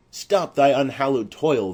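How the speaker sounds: loud, commanding call to halt; angry challenge. Acted. Paris stops Romeo at the tomb, angry shout. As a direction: bark the order across the churchyard.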